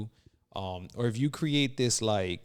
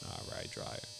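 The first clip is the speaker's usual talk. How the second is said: whispered tease; 13 dB quieter than usual. Retold or imitated. Voicing a friend's teasing.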